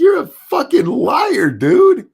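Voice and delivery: high, loud, drawn-out accusation; high-pitched outraged yell; angry rant. Acted. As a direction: call him out at high pitch, dragging the words. Ranting at an absent newsman.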